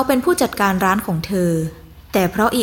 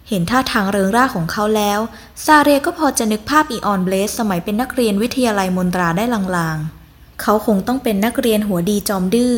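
ordinary delivery

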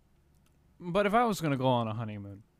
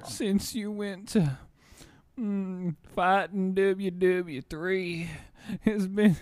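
put-on higher, slow, creaky-old voice; slow old man voice; 3 semitones higher, 4 dB louder, slower than usual. Greeting long-lost friend Trevor, slow old man voice. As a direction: play a doddering old man calling an old friend. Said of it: "[name], you made him old".

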